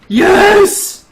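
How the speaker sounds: loud high cheer; excited. Real, not acted. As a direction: shout yes in triumph. Confirms the fix.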